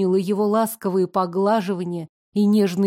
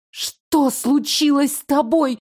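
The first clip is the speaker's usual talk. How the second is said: higher, cracking voice; voice breaking; 5 semitones higher than usual. Acted. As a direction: let the voice break. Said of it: "срывающимся голосом спросила я".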